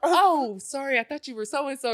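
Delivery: high, sheepish retold apology; embarrassed backpedal in a high voice; oops, wrong person, played up; flustered apology.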